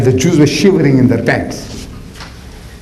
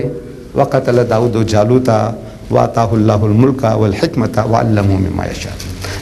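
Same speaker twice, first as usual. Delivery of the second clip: quick recitation; 4 semitones lower, much faster than usual. Acted. Quran recitation.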